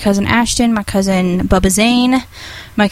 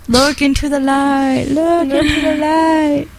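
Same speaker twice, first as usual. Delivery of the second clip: slow, higher hypnotist voice; mock hypnotist; 5 semitones higher, slower than usual. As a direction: draw it out like a hypnotist.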